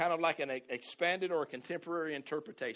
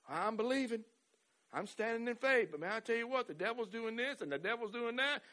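mock complaining believer; 6 semitones higher than usual. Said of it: "Can you see a difference?".

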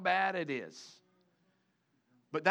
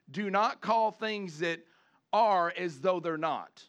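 slow emphatic correction; slower than usual. Real, not acted. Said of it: "stressing false verse".

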